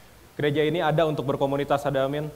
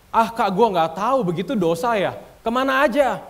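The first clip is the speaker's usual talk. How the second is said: incredulous; 6 semitones higher, 4 dB louder than usual. Acted. Preacher mimics clueless teens, retorts.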